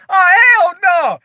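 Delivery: high, loud "oh, hell no"; indignant shock. Retold or imitated. Telling how he came back from death, high loud refusal.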